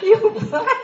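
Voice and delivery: high, loud, incredulous laugh-cry of disbelief; sisters' astonished squeak; shocked disbelief. Retold or imitated. Sisters react in shock as she stands up to dad.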